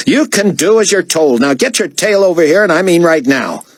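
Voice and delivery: high, imperious diva order; bossy artiste, angry. A narrator's reading. Artiste cutting off David's excuse, imperious and angry. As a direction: cut in with a high, bossy command.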